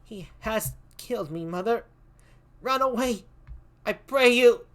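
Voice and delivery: put-on high, urgent child's cry; dying child's plea. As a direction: beg the mother to flee. Dying child begs mother.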